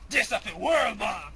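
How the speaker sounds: loud hyped shout; wrestling promo. Acted. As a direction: yell it out proudly. Shouted announcement.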